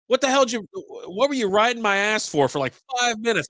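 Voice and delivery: high, exasperated retold complaint. Patient griping at the telehealth screener in retelling, exasperated.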